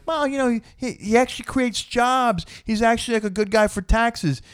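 a hint of a voter justifying himself; mock reasonable voter. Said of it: "they're not doing like".

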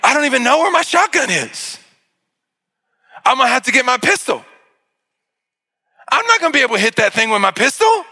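man racing to find a gun, frantic and fast; frantic thought. Retold or imitated. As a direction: rush through the thought in a panic. Panicked inner thought.

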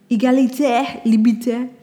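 a self-consciously bad French accent. Shown as a demonstration. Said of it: "that was such a horrible accent".

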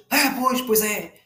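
lightly ape the fans agreeing; a hint of smug spectators nodding along; mocking football fans. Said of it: "alguém grita e eles".

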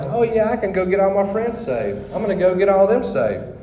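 mock the overconfident new convert; naive eagerness. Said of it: "So I was like".

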